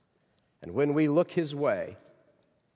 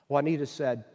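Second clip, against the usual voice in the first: ordinary talk, nothing marked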